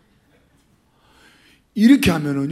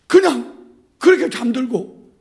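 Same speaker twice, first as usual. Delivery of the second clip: normal talking voice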